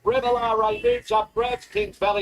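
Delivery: high, rising finish call; excited race call. Real, not acted. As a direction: call the winner home.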